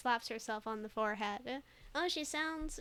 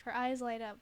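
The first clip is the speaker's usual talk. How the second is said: ordinary talk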